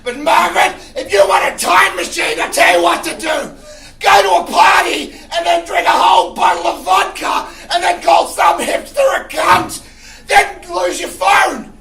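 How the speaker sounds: yelling unhinged boss; boss from hell. Pretend. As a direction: manic screaming office boss. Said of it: "this is my impression of a world where I have a secretary".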